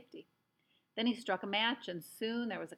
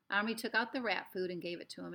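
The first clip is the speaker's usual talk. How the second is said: ordinary delivery, nothing marked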